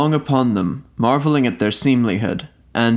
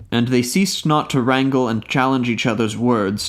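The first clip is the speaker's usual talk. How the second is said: usual speaking voice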